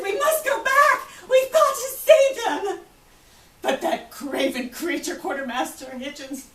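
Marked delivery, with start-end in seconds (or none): 0.0-2.8 much higher and louder, pleading; desperate shout. Retold or imitated. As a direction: shout it, begging to turn back. Pleading to rescue drowning people.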